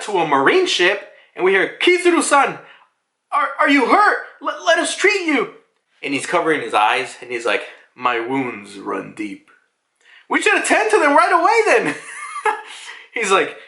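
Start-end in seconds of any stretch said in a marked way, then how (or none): 1.8-5.5 high, stammering concern; high-pitched anxious mock plea; worried underling. Marines worried about injured superior. 8.0-9.5 low, slow, soft mock gravitas; drawn-out low murmur of hammed-up sorrow; brooding deep. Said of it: "trying to be deep". 10.3-12.0 high, fast mock alarm; urgent high-pitched gabble; panicked underling. Panicked marines answering the wounded man.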